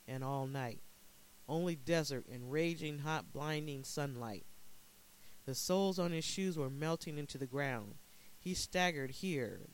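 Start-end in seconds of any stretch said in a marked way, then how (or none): none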